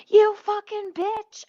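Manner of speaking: high, loud, slow mock yell of an insult; mock scream. Retelling a driver screaming at her while leaving, high and loud. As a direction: hurl it like a driver screaming out the window. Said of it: "drove away screaming".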